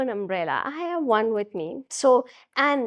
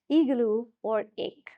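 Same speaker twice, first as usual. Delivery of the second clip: ordinary talk, nothing marked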